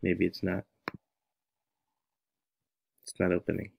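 ordinary delivery

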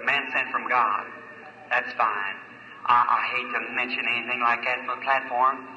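ordinary delivery

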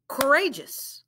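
"Courageous" said smoothly as one word; blended "Courageous". Shown as a demonstration. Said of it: "Let's blend it".

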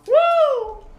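very high, loud "woo"; piercing cheer; excited whoop. Real, not acted. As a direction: whoop in celebration. Celebrating in the game.

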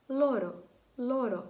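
лоро with a single light tap of р; barely touch the р in лоро; light tapped r. Shown as a demonstration. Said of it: "в мене така взагалі ледь-ледь Р". (лоро з ледь чутним р)

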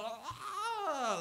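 high, drawn-out mock wail; imitated wailing. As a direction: imitate someone wailing in tears. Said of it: "like, wailing".

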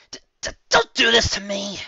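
high, loud, stammering plea; stammered. Acted. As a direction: choke on the first word, then burst out. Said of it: "she stammered".

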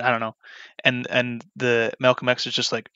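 usual speaking voice

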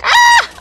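high, loud mock scream; imitated scream. Gamer imitating constant screaming, high mock scream. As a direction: mimic someone screaming all the time. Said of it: "They're screaming all the time like".

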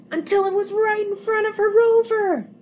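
startled high cry of alarm; sudden alarm. A narrator's reading. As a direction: jump up in pitch as the robot looms. Sudden robot appears.

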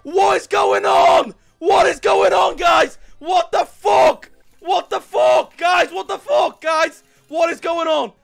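loud, slow, stunned shouting; disbelieving screaming. Real, not acted. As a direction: scream like you can't believe it. Massive win, screaming in disbelief.

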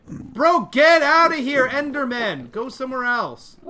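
high, loud yell at a mob; shooing yell. Real, not acted. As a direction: chase it off, bro. Shooing an Enderman away.

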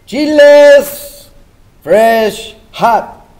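loud street-vendor cry; vendor calling out. Acted. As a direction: call it out to passing shoppers.

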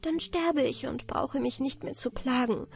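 put-on girl despairing in her thoughts. A narrator's reading. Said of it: "verzweifelt ... und dachte".

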